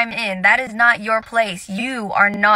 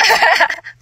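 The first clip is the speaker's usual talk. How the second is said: a quick higher laugh at her own joke; bright laugh; 9 semitones higher, 5 dB louder than usual. Real, not acted. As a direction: burst out laughing, pitched up.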